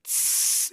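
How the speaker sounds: long unvoiced hiss through teeth. Shown as a demonstration. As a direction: let air out on a steady S. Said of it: "me going like this".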